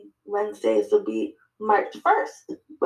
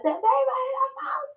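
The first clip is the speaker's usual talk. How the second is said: excited announcement. Retold or imitated. Telling her partner about the milestone, high and thrilled. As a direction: squeal the news to your partner. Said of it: "I screamed".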